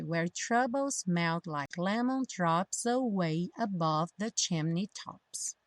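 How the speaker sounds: slow repeat, "drops away" joined; linked pronunciation practice. Shown as a demonstration. Teacher repeating the tricky part, slow line with "drops away" linked. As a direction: say it slowly, gliding through "drops away". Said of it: "o drops junta com away".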